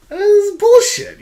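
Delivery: exasperated rant at a bad card; indignant outburst. Real, not acted. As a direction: spit out the complaint in disbelief. Ranting about the silence card.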